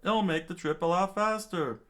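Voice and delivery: a townsperson urging them on; eager villager pitched high; townspeople voice. Acted. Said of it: "the townspeople screamed out".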